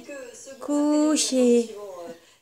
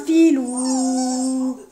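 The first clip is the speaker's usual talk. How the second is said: normal talking voice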